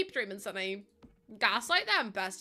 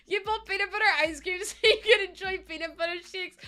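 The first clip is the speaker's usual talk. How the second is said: high, louder talk through laughter; laughing tease; 9 semitones higher, 6 dB louder than usual. Real, not acted. Teasing a viewer about ice cream, laughing. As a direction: giggle while teasing the viewer.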